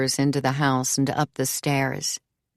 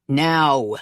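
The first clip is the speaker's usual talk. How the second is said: loud fatherly command; firm, forceful demand; 5 dB louder than usual. A narrator's reading. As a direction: order it, cutting him short. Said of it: "Billy commands in precisely the tone".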